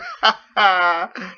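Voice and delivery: a high, loud laugh behind a mask; muffled chuckle. Acted. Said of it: "Fritz chuckled".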